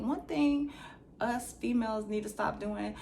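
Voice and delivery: ordinary talk, nothing marked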